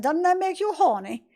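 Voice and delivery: a hint of a high Austin Powers; quick, badly done spoof of the spy; Austin Powers impression. Player jokes mid-cutscene, lightly doing a bad Austin Powers. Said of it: "the worst Austin Powers impression".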